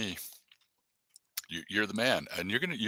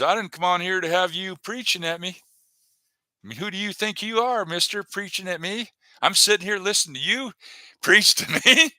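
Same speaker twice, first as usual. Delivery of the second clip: half-joking offended viewer, pitched up; mock indignant Natalie; 6 semitones higher, 4 dB louder than usual. Retelling viewer Natalie scolding him for preaching, mock offended. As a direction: play her scolding him for preaching, partly laughing. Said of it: "She says".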